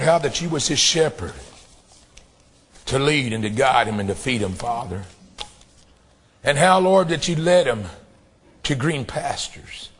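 low, hushed reverent prayer. Acted. Soft opening prayer.

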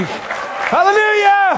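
very high, slow, sustained cry of praise; the preacher crowning the sermon with praise; exultant hallelujah. Real, not acted. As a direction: let the hallelujah ring out.

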